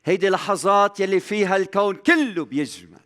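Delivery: high, loud, mounting sermon delivery; fervent preaching. Acted. Preacher to his brothers on God's compassion, fervent and loud. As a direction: preach it hard, building each line.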